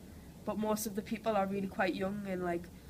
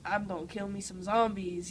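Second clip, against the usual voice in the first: American zombie-killer character voice; playful American accent, acted out. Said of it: "she kinda talks like this".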